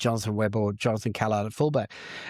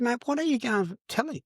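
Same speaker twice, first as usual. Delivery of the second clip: challenging a stranger; 11 semitones higher, faster than usual. Retold or imitated. Confronting the gossiping bloke.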